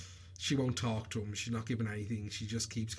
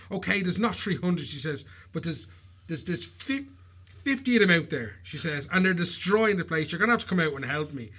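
raised, louder pleading wife voice; nagging wife; 5 semitones higher, 5 dB louder than usual. Retold or imitated. Wife insisting to her husband about geese, raised and loud. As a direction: pester him like an anxious wife.

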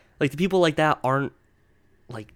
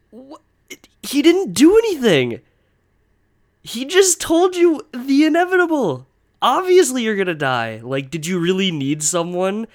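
higher and louder, disbelieving rant; exasperated outburst; 8 semitones higher, 7 dB louder than usual. Real, not acted. Ranting to listeners about a pointless viral video, loud and exasperated. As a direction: vent at how pointless the advice was.

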